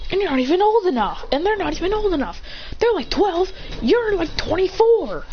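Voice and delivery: high-pitched indignant retort; acted protest. Character arguing that they're not old enough, high protesting voice.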